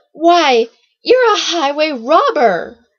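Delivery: loud, high indignant accusation; angry outburst. A narrator's reading. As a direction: burst out indignantly, drawing out the words. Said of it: "Siggy felt his anger rise".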